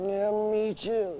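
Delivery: high, slow sleepy dummy; puppet voice. Acted. Dummy Orson just woken from a nap, high slow puppet voice. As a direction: drag it out as a drowsy puppet.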